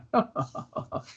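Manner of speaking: high, soft, breath-only chuckle; merry chuckle to himself. Acted. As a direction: laugh quietly to yourself, pleased. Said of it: "laughs to himself merrily".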